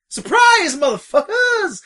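loud, high-pitched triumphant shout; spring it on them at full volume; big hammed-up reveal, last word stretched; mock surprise announcement. Mock studio announcing a surprise film.

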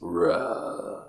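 low dragon roar; dragon impression. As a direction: growl it like a dragon. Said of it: "That's my dragon impression".

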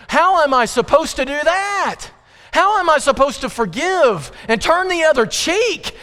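high, pressing complaint; high frustrated outcry; exasperated protest. Retold or imitated. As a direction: voice the sufferer pleading with God. Voicing the sufferer's protest.

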